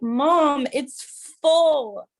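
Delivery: put-on high, insistent little boy; kid's voice. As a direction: whine it like a young son. Son voiced.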